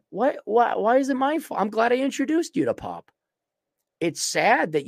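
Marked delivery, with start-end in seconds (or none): 0.1-3.1 raised whiny pitch; mock wounded protest; high mock whine.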